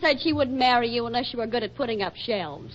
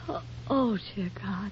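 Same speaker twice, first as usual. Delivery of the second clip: faint with dread; 5 semitones lower, 10 dB quieter than usual. Acted. Wife nearly faints at news.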